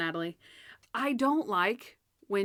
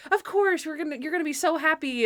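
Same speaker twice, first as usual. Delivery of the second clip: cheery Natalie; 7 semitones higher than usual. Retold or imitated. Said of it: "She's like".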